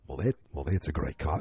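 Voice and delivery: put-on a low suave try at a British accent; James Bond impression. Reviewer retrying a Bond line, low mock English accent. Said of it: "I can do better".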